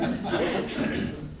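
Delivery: breathy little laugh; a quiet amused chuckle; soft laugh. Real, not acted. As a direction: laugh softly at the memory. Amused at her own marriage story.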